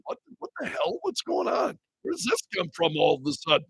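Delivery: high, hushed voice of baffled onlookers; mock bafflement. Host voices everybody puzzled by the sudden accent, high mock bafflement. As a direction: squeak it softly in mock confusion. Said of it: "'cause everybody's going".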